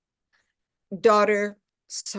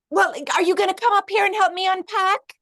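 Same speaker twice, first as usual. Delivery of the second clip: much higher, fast, pushy; demanding daughter; 11 semitones higher, 5 dB louder, faster than usual. Acted. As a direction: press mom to come help. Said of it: "So mother".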